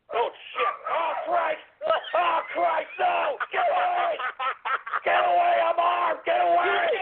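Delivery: hammed-up terror; panicked yelling. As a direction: shout in mock panic. Panicked in-game chase.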